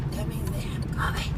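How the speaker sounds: a hushed, excited whisper. Real, not acted. Whispering to viewers after spotting the packed Starbucks. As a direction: whisper the good news to the camera.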